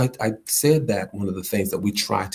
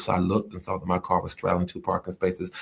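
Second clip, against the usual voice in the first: ordinary delivery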